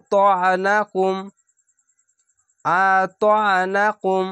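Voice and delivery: আতানাকুম with a clear throat catch on the ain; আতানাকুম, ain held. Shown as a demonstration. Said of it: "আইনটিকে ধরতে হবে".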